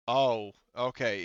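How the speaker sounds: ordinary delivery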